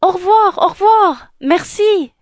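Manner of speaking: high, loud, flustered farewells; called while running. Acted. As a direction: call goodbye back as you flee. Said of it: "dit Cendrillon en courant". (adieux criés en courant)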